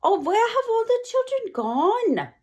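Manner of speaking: high fretful teacher voice; teachers crying out. Acted. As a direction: wail it in worry. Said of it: "The teachers cry".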